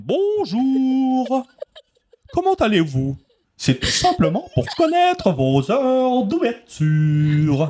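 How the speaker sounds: high, drawn-out theatrical caller; over-the-top character. Acted. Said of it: "j'étais en gros personnage". (gros personnage théâtral, voix haut perchée)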